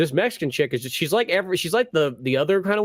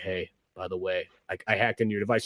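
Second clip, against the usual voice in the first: sneaky low phone voice; 9 semitones lower, 7 dB quieter than usual. Retold or imitated. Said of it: "somebody's like".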